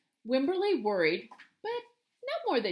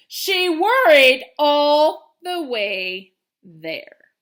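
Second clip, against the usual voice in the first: very slow, louder, drawn-out; slow emphatic narration; 2 semitones higher, 12 dB louder, much slower than usual. A narrator's reading. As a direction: drag it out for effect. Narrator stretching the worry.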